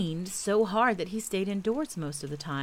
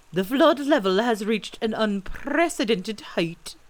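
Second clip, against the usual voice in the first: loud, higher owl proclamation; pompous Owl; 4 semitones higher, 6 dB louder than usual. A narrator's reading. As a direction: announce it grandly, relishing each long word. Owl pronouncing in long words.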